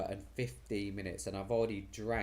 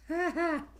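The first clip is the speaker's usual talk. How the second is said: high burst of laughter; 4 dB louder than usual. Real, not acted. Challenge result shows.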